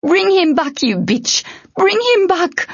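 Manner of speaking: high-pitched furious shout. A narrator's reading. Tern as flames wreathe the troll, furious shout. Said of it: "she shouted".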